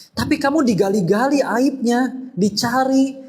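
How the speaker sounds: lightly acted high-pitched objection; high, insistent mock objection; objector's voice. Voicing an objector's taunt.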